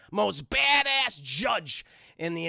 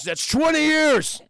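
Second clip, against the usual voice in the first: loud, high, drawn-out verdict; booming sentence; 7 semitones higher, 7 dB louder, slower than usual. Retold or imitated. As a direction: proclaim it slowly like an over-the-top judge. Voices judge pronouncing sentence.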